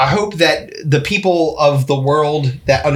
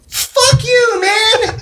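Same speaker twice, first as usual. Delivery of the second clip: much higher fan yelling at the screen; mock outraged shout; much slower than usual. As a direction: shout it like a betrayed comic fan. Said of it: "you're like".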